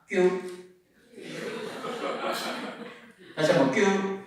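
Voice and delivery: "뀨" said as a cute tense "kkyu" with a finger heart; a cutesy "뀨" for the kids to copy; cute kyu. Shown as a demonstration. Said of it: "자, 따라하겠습니다". (귀엽게 "뀨")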